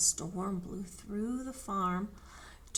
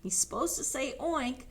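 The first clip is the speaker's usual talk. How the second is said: ordinary delivery, nothing marked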